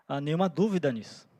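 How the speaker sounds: normal talking voice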